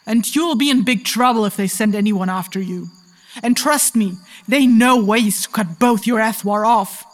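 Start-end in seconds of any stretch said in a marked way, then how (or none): 4.5-7.0 loud, slow, deliberate warning; stern threat. Acted. Captor warning the captive against tricks, stern threat. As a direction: drive the threat home word by word.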